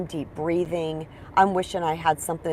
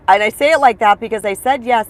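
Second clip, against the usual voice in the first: ordinary delivery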